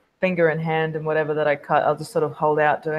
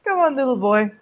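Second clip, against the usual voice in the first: high sing-out easing down to usual pitch; taunting coaxing; 8 semitones higher, 3 dB louder than usual. Acted. Vampire tempts an addict with a drop, coaxing. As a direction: coax them like a little pet.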